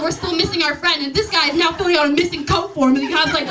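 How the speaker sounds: usual speaking voice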